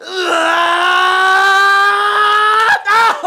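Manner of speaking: long, high, loud shriek; shrieking reaction. Real, not acted. Friend just slapped hard, shrieking reaction. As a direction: hold a piercing high cry that breaks into laughter.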